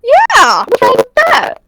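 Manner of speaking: very high, defensive squeak; high mock defense.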